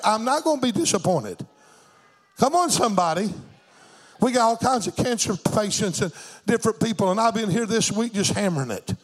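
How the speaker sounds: ordinary delivery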